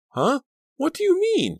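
very high, loud shriek of disbelief. A narrator's reading. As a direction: shriek it in alarm. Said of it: "Danielle shrieked".

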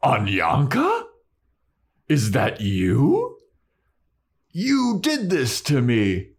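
slow, swelling troll recognition. Retold or imitated. Troll recognising Anya, slow troll voice turning accusing. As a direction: ask slowly in disbelief, then accuse, pitch rising. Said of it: "the troll's like".

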